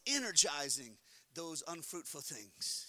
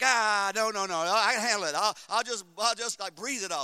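denial; 11 dB louder than usual. Retold or imitated.

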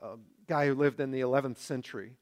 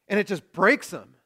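usual speaking voice

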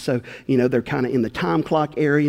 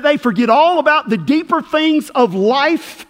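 louder, fervent preaching; 7 semitones higher, 5 dB louder than usual. Acted. Preacher warning the congregation about sin, full force. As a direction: drive the warning home.

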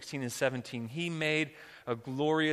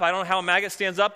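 ordinary delivery

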